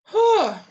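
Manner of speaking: a loud, high sigh to calm the nerves; shaky sigh. Real, not acted. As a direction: push the panic out in one sigh. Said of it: "Just gotta breathe for a minute".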